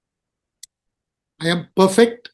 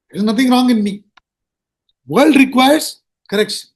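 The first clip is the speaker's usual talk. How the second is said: egoist's boast; faster than usual. Retold or imitated. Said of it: "what they say is".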